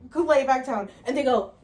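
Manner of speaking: usual speaking voice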